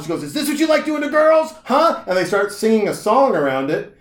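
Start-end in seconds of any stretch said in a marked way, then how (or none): 0.2-2.0 high, loud taunting demand; mock angry confrontation. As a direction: imitate her attacking him with the question. Acted woman confronting the man.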